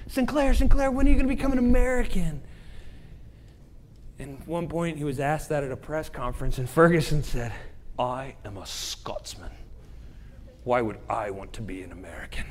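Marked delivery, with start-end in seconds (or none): 0.1-2.4 high, fast, louder nagging imitation; pestering questioner. People keep asking the Scottish theologian, high nagging voice. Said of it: "he's always asked". 8.0-12.5 low, quiet attempt at a Scottish accent; lightly imitated Scottish theologian, lower and softer. Theologian answers a press conference, soft Scottish accent. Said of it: "this amazing Scottish accent".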